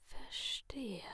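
sinister masked figure in a soft whisper; menacing whisper from behind a mask; creepy whisper. Acted. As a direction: purr the threat like a friendly killer. Letty whispers.

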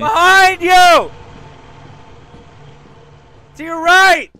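high, loud, urgent callout; shouted warning. Real, not acted. Player warns teammate of danger behind, urgent shout. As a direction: yell it across to warn a teammate.

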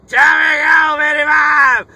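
slow, loud announcer yell; shouted channel name. Real, not acted. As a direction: call out his name like a ring announcer.

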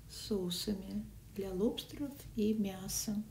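usual speaking voice

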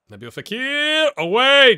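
excited call. Real, not acted. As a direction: call the run as it breaks.

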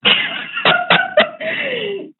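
tense trader's giggle; high laugh. Real, not acted. Nervous laughing at market.

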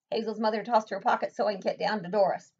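ordinary delivery, nothing marked

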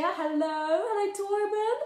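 usual speaking voice

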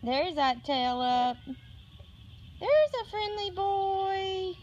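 high, sing-song cooing at a cat. Real, not acted. Owner coaxing her cat Marvin over, high cooing baby talk. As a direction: coo sweetly to coax the kitty closer.

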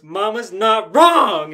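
hammed-up slow yell; mock yell. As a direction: bellow it like a riled-up kid. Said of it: "And then she goes".

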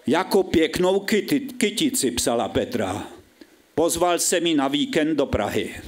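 measured, drawn-out stage reading with falling line endings; dramatic story reading. Acted. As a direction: read it out as mock-solemn literature. Reads his story on stage.

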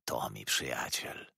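low, slow, soft mutter; quiet approving murmur. A narrator's reading. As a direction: murmur it slowly to yourself. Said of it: "mruczał".

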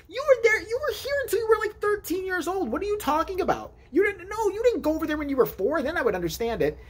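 high, exasperated disbelief; incredulous brother scolding, voice up high; incredulous high ranting. Acted. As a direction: pitch way up, demanding answers from him. Mock outraged questioning of brother.

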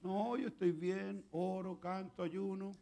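slow, even, drawn-out recital; mock a complacent Pharisee listing good deeds; self-righteous Pharisee. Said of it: "los fariseos".

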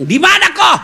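high and loud, demanding where he is; shouting jealous wife. Retold or imitated. Jealous wife shouting down the phone at husband.

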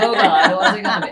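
laughing, loud mock dread. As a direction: laugh through the nervous question. Dreading father's advice, laughing.

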